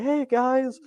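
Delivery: begging. Acted. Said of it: "he's, like, begging".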